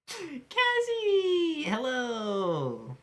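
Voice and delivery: high, loud happy call; excited greeting. Real, not acted. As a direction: call out delighted to see her. Greets a viewer arriving.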